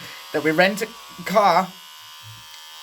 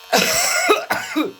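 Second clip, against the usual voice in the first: harsh high hacking cough; hacking cough after a hit; real coughing fit. Real, not acted. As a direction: cough through the smoke.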